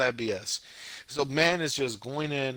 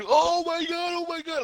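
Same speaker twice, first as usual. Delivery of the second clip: very high-pitched mock panic; panicking anime guy; 6 dB louder than usual. Podcaster acting out the cuts back to him, mock panic. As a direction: squeal it in hammed-up alarm. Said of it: "cuts back to him going".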